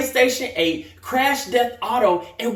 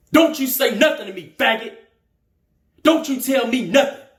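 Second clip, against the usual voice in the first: louder, higher angry warning; angry threat; 4 semitones higher, 7 dB louder, faster than usual. Acted. Kevin warning the narrator in the barbershop, angry threat.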